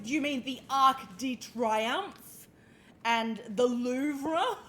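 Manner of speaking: put-on slow drawn-out Australian Bogan accent; a mock Australian Bogan voice sneering at the French names. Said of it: "put a real Bogan accent on".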